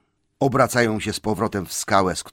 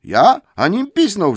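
much higher, louder outrage; outraged troll; 13 semitones higher, 9 dB louder than usual. Acted. Tom loudly protests his innocence. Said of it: "rzekł Tom".

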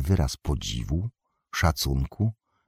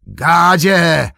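much higher, very loud shout; louder call; 12 semitones higher, 10 dB louder than usual. Acted. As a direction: yell the name across the yard. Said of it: "zawołał Saruman".

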